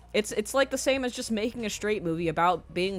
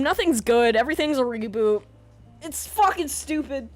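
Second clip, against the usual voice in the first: ordinary talk, nothing marked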